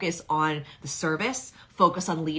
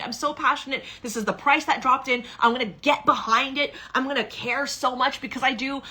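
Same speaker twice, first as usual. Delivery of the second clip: all-in conviction; 7 semitones higher than usual. Retold or imitated. Coach voicing a leader who goes all in, fired up. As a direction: declare it like you're betting everything on yourself. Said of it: "It has to be".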